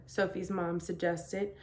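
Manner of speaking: ordinary delivery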